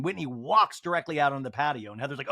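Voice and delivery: ordinary talk, nothing marked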